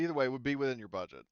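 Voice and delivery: usual speaking voice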